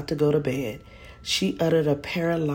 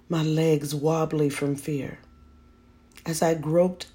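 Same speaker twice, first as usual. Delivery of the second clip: plain reading voice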